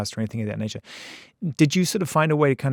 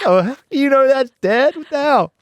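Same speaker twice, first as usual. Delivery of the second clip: surprised; 12 semitones higher, 6 dB louder, slower than usual. Retold or imitated. Surprised at dad singing.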